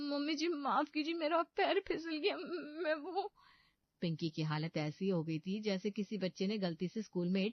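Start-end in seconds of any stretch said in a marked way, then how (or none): 0.1-3.3 much higher, tearful, stammering apology; panicked apology. Acted. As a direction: beg forgiveness on the verge of tears. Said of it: "Pinky घबरा जाती है".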